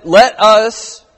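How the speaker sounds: "us" hit hard and high; stress on us. Shown as a demonstration. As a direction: lean hard on the word "us". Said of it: "emphasis on the word us".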